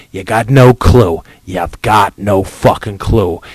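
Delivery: normal talking voice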